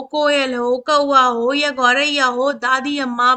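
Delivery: usual speaking voice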